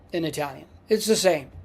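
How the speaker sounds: ordinary delivery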